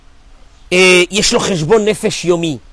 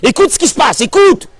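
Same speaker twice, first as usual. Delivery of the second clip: pleading call of God, louder and higher; insistent divine call, rising higher each time; 8 semitones higher, 4 dB louder than usual. Acted. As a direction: urge him to listen, voice climbing to a high cry. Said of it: "Et Dieu, il te dit".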